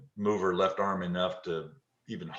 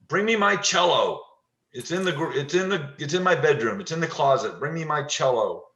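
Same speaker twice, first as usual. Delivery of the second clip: urgent demand; 7 semitones higher, 4 dB louder than usual. Retold or imitated. Dying patient demanding her cello.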